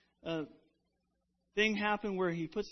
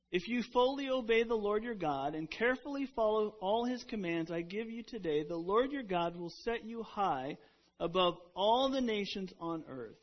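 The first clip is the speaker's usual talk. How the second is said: louder, declaiming Bible verse; scripture reading; 3 semitones higher, 4 dB louder than usual. Acted. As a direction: proclaim the verse to the congregation. Reads scripture aloud.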